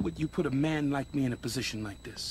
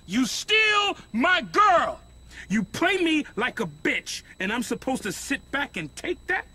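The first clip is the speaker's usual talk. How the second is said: furious shout; 5 dB louder than usual. Acted. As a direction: explode at the man who stole your girl. Boss confronts rival over girl.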